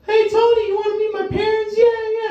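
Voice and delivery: put-on squeaky high child voice; shrill happy kid answering. Kids answering Tony the Tiger, very high. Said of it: "Kids go".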